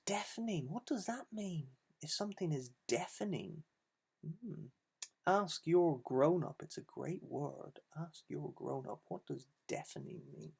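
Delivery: soft, hushed question; quiet aside. Acted. Asking the children about a word, softly. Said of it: "quiet aside to children".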